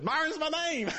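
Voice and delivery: giggling it out high as he tells it; laughing. Retold or imitated. Preacher retelling his reply to the cheerleader, laughing high.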